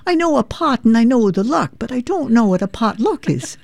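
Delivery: puzzled Irish mum asking about a potluck; Irish mother. Retold or imitated. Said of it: "my mother would say".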